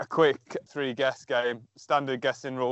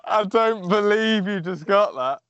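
loud, drawn-out, amused disbelief; laugh, then slow right down in astonishment; 5 semitones higher, 4 dB louder, slower than usual. Real, not acted. Quiz setter stunned by the correct guess, laughing disbelief.

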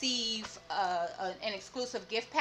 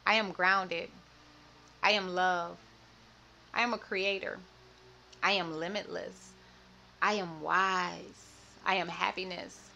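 self-love affirmation; 2 semitones lower than usual. Acted. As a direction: declare every line like a vow. Go ahead and repeat after me.

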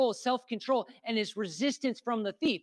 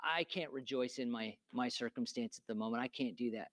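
lower, much softer mopey voice, imitating someone stuck in a funk; mock gloomy whine; 10 semitones lower, 10 dB quieter than usual. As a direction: mumble it like a sulking churchgoer. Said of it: "we're like".

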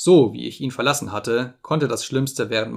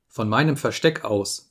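ordinary delivery, nothing marked

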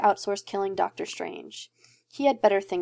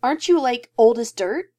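louder mocking jab; cheeky jibe, louder; teasing disbelief; 4 semitones higher, 7 dB louder than usual. A narrator's reading. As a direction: needle him about his age.

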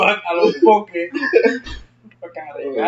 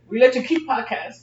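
mock Chinese accent; 7 semitones higher, 4 dB quieter than usual.

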